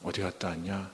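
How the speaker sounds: low, soft resigned question; mildly acted weary teacher, voice dropped; drained of anger. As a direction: ask it quietly, with no anger left. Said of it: "이제 화도 안 나요".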